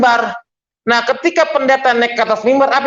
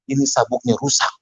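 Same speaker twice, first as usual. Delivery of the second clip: softer, lower embarrassed mumble; sheepish reply to the stewardess, much softer; 8 semitones lower, 5 dB quieter than usual. Retold or imitated. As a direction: cover up your embarrassment quietly.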